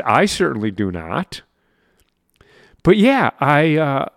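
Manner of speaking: usual speaking voice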